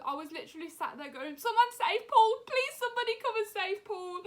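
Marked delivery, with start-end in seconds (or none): 1.4-4.2 high, wailing re-enacted plea; loud start, high tearful begging; desperate pleading. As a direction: cry out for help, hammed-up and high.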